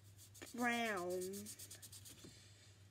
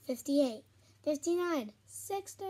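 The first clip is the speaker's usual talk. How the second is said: counting down excitedly; 3 semitones higher, 7 dB louder, slower than usual. Real, not acted. Finishing the sixty-second count.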